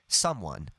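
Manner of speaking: "someone" with weight on "some", falling away on "one"; stress on SOME. Shown as a demonstration. Teacher showing natural word stress, "someone" stressed on "some". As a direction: stress the first syllable of "someone". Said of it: "The stress is on some".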